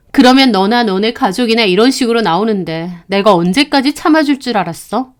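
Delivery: usual speaking voice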